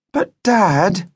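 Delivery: put-on high, pleading child's protest. A narrator's reading. Daughter objects to her father's refusal, high protest. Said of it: "protested Judy".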